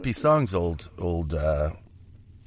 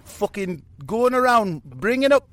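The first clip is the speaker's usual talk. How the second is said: high, loud Geordie rant; a Geordie rock star preaching about the world; 10 semitones higher, 4 dB louder than usual. Retold or imitated. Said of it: "The old Geordie".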